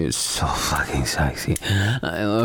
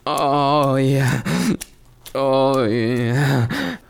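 rising moans; 8 semitones higher, much slower than usual. Acted. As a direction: let the pleasure build and climb. Climbing moans of pleasure.

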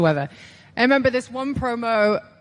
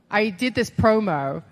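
normal talking voice

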